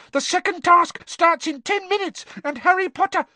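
high, loud elf squeak; squeaky house elf. Acted. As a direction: squeak it out urgently. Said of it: "squeaked Dobby".

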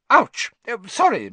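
yelp, then recover politely; teacher skidding into a student; startled ouch. Acted. McGonagall skids, grabs Hermione.

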